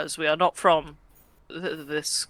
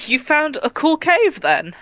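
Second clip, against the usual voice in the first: excited; 9 semitones higher, 4 dB louder than usual. Acted. NPC child asking excitedly.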